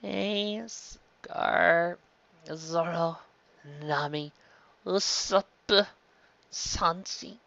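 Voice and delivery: soft, fading drowsy list; sleepy, fading voice; drowsy Luffy. Acted. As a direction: drift off under anaesthetic, names fading. Luffy going under.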